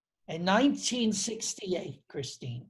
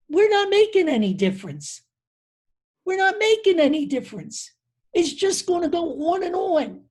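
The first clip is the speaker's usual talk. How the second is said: high and louder, a retold tearful outburst; re-enacting a distraught colleague's tearful cry of hopelessness; imitating a crying despair; 10 semitones higher, 5 dB louder than usual. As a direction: voice her anguish as she weeps, pitched up and loud. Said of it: "And she started crying".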